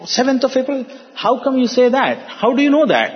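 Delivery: high-pitched imitation of a doubting father; incredulous questioning. Scientist father questioning the holy man's prediction, incredulous. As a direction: challenge it in disbelief, pitched well up.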